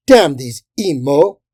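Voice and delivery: loud, slow curse; crying out. A narrator's reading. Woman crusader cursing the evil devils, loud cry. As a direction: curse them at full voice. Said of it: "the woman cried again".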